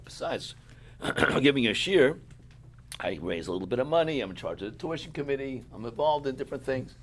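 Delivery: ordinary talk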